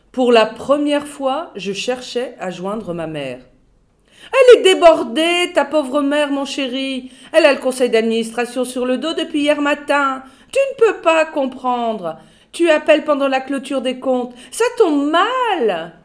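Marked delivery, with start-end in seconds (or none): 4.3-15.9 high, loud, sugary office voice; chatty secretary. A narrator's reading. Secretary brushing off the boss's son, high and loud. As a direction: coo at him while filing your nails. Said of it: "C'était Grunda, la secrétaire".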